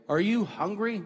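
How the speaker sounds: restrained guard asking "hungry"; scripted guard question. Retold or imitated. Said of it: "They're allowed to say".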